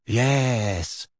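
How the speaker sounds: put-on airy, drawn-out dreamy girl. A narrator's reading. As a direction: say it as if drifting off in a daydream. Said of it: "said Luna dreamily".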